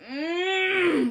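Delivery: high, loud strained "mmm"; exasperated hum through closed lips; frustrated groan. Retold or imitated. Painter fuming at the rude wife, frustrated groan. Retold frustration, buttons being pushed.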